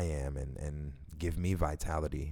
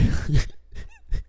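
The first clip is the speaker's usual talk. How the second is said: a breathy, much higher squeal of a laugh; 9 dB louder than usual. Real, not acted. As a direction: laugh in a high squeak at the memory. Laughing at his own squeal.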